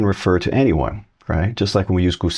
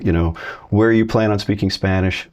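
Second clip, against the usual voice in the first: ordinary talk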